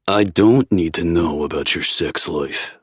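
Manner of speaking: ordinary delivery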